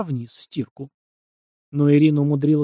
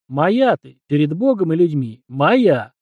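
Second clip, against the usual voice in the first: loud, fierce, possessive; possessive declaration; 5 dB louder, slower than usual. A narrator's reading. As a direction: declare her yours, fiercely. Groom claims bride, possessive. (громко и яростно)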